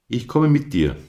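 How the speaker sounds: Captain eagerly volunteers; Captain offers help. A narrator's reading. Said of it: "sagte Captain etwas".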